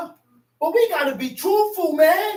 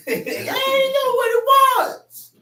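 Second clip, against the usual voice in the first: high, slow, laughing cry; laughing preaching shout; 9 semitones higher, 3 dB louder, much slower than usual. Real, not acted.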